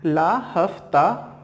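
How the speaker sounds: slow, deliberate ला हफ्ता; very slow mnemonic. Shown as a demonstration. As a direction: stretch out ला हफ्ता for memorising. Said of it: "ठीक है".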